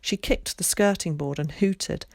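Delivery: usual speaking voice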